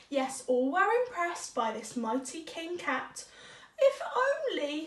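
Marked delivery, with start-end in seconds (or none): 3.8-4.9 mouse wishing. Acted. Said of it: "thought Mouse".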